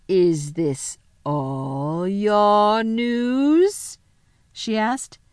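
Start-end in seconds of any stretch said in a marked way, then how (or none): 0.1-4.0 very slow, drawn-out question; menacing witch. Acted. The witch questions Edmund, very slow menacing voice. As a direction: stretch every word, climbing in threat.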